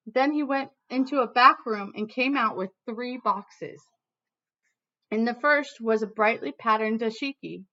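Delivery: lively read-aloud storytelling voice; reading to children. Acted. Teacher reading a picture book to her class, lively storytelling.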